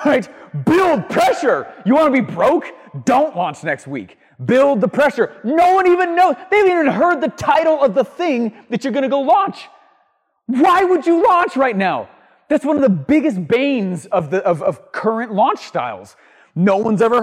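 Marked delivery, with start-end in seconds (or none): 0.7-12.1 high, loud, pleading push; raised voice, almost shouting at the listener; exasperated rant. Real, not acted. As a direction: beg them not to launch yet. Exasperated rant against early launches.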